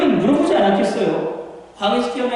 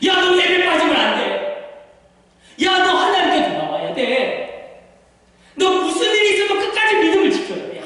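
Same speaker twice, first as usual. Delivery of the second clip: high-pitched, insistent parent plea; urgent parental exhortation; 9 semitones higher than usual. Acted. Parent telling child to keep the faith, urgent exhortation. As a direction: exhort your child with fervor.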